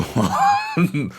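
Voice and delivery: quick high-pitched laugh; high giggle. Real, not acted. Laughing to the viewers while searching online, high giggle. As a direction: giggle mid-sentence.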